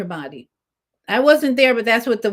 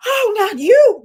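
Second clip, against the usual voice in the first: high, loud mock-shocked cry; imitating stunned acquaintances; shocked gasp; 11 semitones higher, 8 dB louder than usual. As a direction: act out people's disbelief, loud and high. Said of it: "People are shocked".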